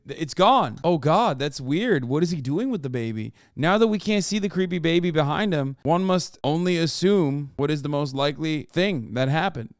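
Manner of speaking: ordinary delivery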